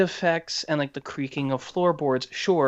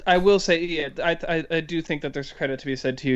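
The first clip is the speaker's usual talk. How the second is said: ordinary talk, nothing marked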